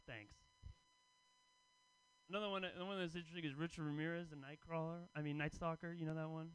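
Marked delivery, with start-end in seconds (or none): none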